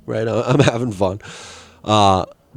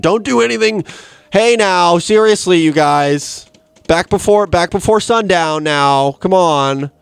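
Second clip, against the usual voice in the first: much higher, louder, nagging like a worried mom; fussy worried parent; 10 semitones higher, 4 dB louder than usual. Retold or imitated. Boy warning the older kids to be careful, shrill like a parent. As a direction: play the eleven-year-old lecturing like a parent. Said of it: "I'm going".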